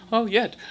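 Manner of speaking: "Oh, yet" lifted high, light; "yet" realized. Shown as a demonstration. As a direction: discover "yet" with a bright high lift. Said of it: "you know".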